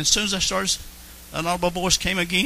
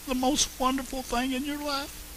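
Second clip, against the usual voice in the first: softer tender repeat; 7 semitones higher, 6 dB quieter than usual. Real, not acted. Preacher softly echoing his own words to the congregation, tender. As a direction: drop the voice, say it gently and tenderly.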